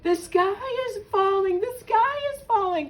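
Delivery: high, urgent storybook hen cry; high-pitched cry of alarm. A narrator's reading. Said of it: "cried Henny Penny".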